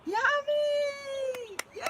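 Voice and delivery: high loud squeal of yummy; delighted yelp; excited shout. Real, not acted. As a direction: shout it out with glee. Excited reaction to chat.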